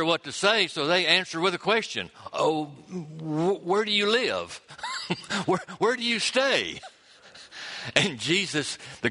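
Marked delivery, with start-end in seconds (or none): 2.3-6.8 high, fumbling reply with a little laugh; flustered disciples. Acted. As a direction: stammer it out, caught off guard.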